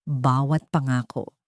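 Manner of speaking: ordinary delivery, nothing marked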